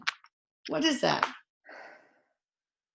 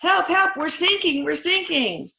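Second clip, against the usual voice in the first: high, loud mock plea for help; distress call; 9 semitones higher, 4 dB louder than usual. Sinking crew radios the Coast Guard, high urgent cry. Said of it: "And then on the radio".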